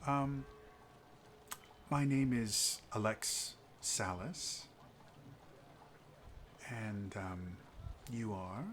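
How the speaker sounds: smooth a diplomatic apology over softly; soft-spoken noble. Acted. Noble Alex continues.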